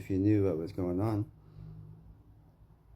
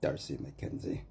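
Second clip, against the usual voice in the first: low, quiet name; 5 semitones lower, 6 dB quieter than usual. Real, not acted. A man muttering the name of the man who wronged him, quiet. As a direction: say the name lower and softer. Saying the name after outbursts.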